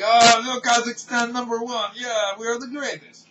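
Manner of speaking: high, put-on Borat-style boast; mock Borat voice, high and hammy; mock Kazakh accent. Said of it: "And Borat made fun of that".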